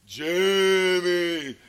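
loud, long-drawn spooky holler; eerie name-calling. Retold or imitated. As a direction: holler the name from a hiding place. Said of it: "and yell his name".

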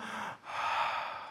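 long, soft exhale; deep breath out. Shown as a demonstration. Pastor helping congregation relax, long soft exhale. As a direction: let all the air go. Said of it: "Take a deep breath in".

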